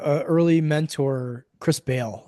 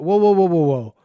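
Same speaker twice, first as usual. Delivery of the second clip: whoa; 5 dB louder than usual. Pretend. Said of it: "a collective cultural conversation that".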